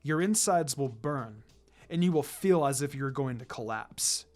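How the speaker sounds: a calm assassin laying down the law to his students; stern sensei Kakashi. Acted. Kakashi addresses his team.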